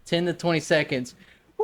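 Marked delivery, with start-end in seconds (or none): 1.6-1.6 very high, long mock "woo"; drunken woohoo. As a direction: imitate a drunk woman's piercing party whoop. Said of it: "She's woohooing every ten seconds".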